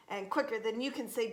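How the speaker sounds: usual speaking voice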